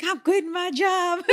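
high, loud, bursting with it; excited announcement. Retold or imitated. Announcing to coworkers she quits, excited and loud. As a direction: blurt out the big news.

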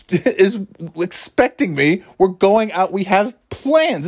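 high, slow whiny husband; meek henpecked husband. Retold or imitated. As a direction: whine it like a pushover husband. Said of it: "he starts imitating me".

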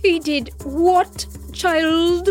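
high, slow outraged exclamation; booming. A narrator's reading. Queen mother shocked at her daughter's news, booming. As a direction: boom it out, stretching each word. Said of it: "the Dragonfly Queen boomed".

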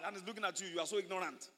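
ordinary delivery

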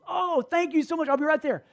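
high-pitched grateful mom voice; gushing thanks. Retold or imitated. Mom thanking the caller who found her phone, gushing. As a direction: gush with relief in a high voice.

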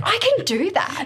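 high, loud, playful imitation. Partner poking her in the stomach, playful. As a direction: crow it like a teasing discovery. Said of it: "my partner's very playful".

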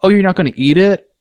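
imitating his mom acting surprised; sarcastic mom. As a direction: sneer it like an offended mom. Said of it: "And then you're like".

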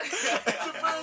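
slow, high laughing prank claim; prank reveal laughing. Acted. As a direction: laugh it out slowly, teasing.

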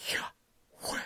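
whispered in-and-out breath sound, "yah... weh"; voiceless inhale and exhale shaping the name. Shown as a demonstration. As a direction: say Yahweh as nothing but breath. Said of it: "God's name, it would be nothing but a breath".

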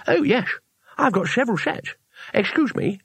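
high, lisping toothless tourist; slushy speech with no teeth in; cheerfully mush it through bare gums; teeth taken out. A narrator's reading. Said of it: "then, in a more normal voice".

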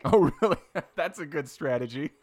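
laughing out the words, pitch up; amused disbelief, laughing through it. Real, not acted. Listener laughing at the island fee, laughing.

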